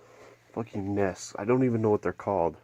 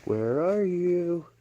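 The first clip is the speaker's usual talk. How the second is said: sing-song calling; 8 semitones higher, much slower than usual. Real, not acted. Searching for hidden bird.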